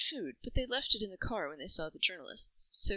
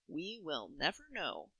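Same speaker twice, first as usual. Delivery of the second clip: slow, quieter firm shutdown; a mother settling it calmly; 9 dB quieter, slower than usual. A narrator's reading. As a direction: close the topic firmly. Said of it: "said his mother firmly".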